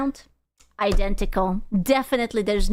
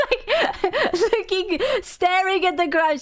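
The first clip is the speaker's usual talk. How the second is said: laughing; 8 semitones higher than usual. Real, not acted. Laughing while retelling funeral.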